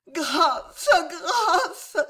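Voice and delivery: high, tearful begging; sobbing. A narrator's reading. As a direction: sob out the plea, very high. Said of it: "sanglota l'épouse martyre".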